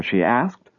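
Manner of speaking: ordinary delivery, nothing marked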